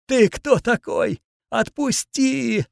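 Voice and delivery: high, slow, agonised plea; pained moan. A narrator's reading. Mutant on his knees with crushed wrist, pained moaning plea. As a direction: moan it out through pain, drawn out. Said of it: "простонал он сквозь боль".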